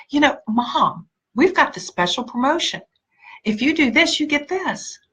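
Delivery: light, eager persuasion; acted sales pitch. Coach acting out telling Mom about the promotion, keen pitch.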